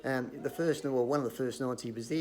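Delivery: ordinary talk, nothing marked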